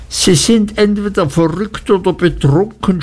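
high-pitched desperate cry; desperate shout. A narrator's reading. Miss Murdstone cries out at the aunt, high and desperate. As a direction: shriek it out in despair. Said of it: "schrie Miss Murdstone in wahrer Verzweiflung".

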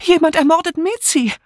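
high-pitched tearful panic; alarmed cry. A narrator's reading. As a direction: cry out in horror, voice way up. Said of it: "rief nun". (entsetzt und schrill gerufen)

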